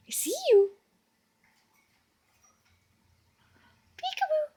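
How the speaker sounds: very high playful peekaboo call. Real, not acted. Playing peekaboo with bird.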